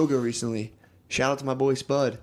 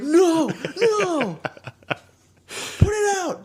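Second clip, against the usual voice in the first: mock panic; much slower than usual. Party host panicking as tiki torch lights, squealing. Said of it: "You're like".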